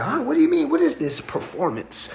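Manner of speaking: high, squeaky "Huh? What do you mean?"; baffled listener. Retold or imitated. Imitating a confused person hearing about performance, high and baffled. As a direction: jump way up in pitch in mock disbelief. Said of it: "you talking to that person like".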